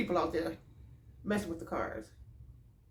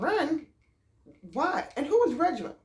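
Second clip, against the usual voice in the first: high, startled questions; retold bewildered reaction; alarmed bafflement; 7 semitones higher than usual. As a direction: fire back questions in disbelief. Baffled by warning to run.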